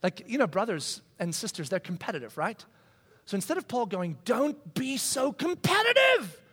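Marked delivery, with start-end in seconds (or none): 4.2-6.4 mock scolding. What Paul might have said.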